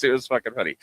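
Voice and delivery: ordinary talk, nothing marked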